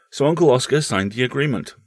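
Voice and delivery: ordinary delivery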